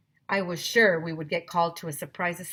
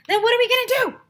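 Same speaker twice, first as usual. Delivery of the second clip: put-on shrill-pitched, loud kid's whine; high whine; 10 dB louder, much faster than usual. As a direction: squeal it out like a frustrated kid. Kid protests in high voice.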